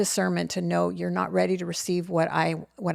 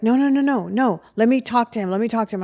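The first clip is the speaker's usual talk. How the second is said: higher, louder rush of no, no, no; urgent pleading; 5 semitones higher, 5 dB louder, faster than usual. Retold or imitated. Nikki begging her mother to wait, urgent higher and louder pleading. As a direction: plead to be allowed to fix it.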